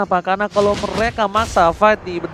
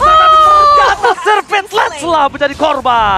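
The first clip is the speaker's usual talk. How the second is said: higher, louder play-by-play; excited caster; 9 semitones higher, 5 dB louder than usual. Acted. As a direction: build urgency as it lands. Caster hypes a kill.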